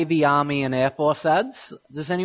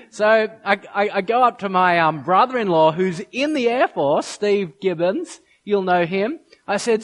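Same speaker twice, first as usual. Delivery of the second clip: ordinary talk, nothing marked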